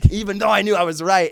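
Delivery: ordinary talk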